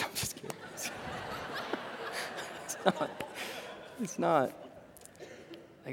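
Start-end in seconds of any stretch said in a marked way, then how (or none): 0.0-0.9 breathy laugh through the words, much softer; laughing whisper. Real, not acted. Preacher joking back at the congregation member, laughing softly. 2.6-3.1 It's not said through a soft laugh; laughing denial. Real, not acted. Preacher still laughing as he insists the story is true.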